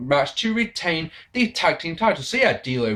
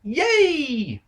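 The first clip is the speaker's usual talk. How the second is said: high mock "Yay!"; hammed-up sarcastic celebration; sarcastic cheer; 12 semitones higher than usual. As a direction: fake delight at something awful. Sarcastic cheer at bad booking.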